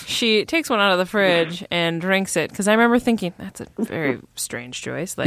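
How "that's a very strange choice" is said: hushed aside. Retold or imitated. Retelling own reaction to film.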